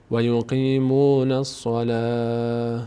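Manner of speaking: الصلاة ending in h as "salah", slow chant; stop on h. Shown as a demonstration. Teacher repeats pausal form for students, final h. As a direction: close الصلاة on a breathy h.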